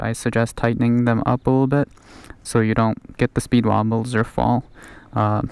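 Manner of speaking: ordinary delivery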